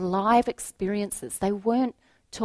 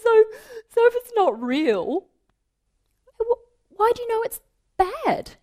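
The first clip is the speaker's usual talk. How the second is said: much higher, louder, giggling through it; laughing question; 9 dB louder than usual. Retold or imitated. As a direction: ask while still laughing. Teacher laughing, questioning child.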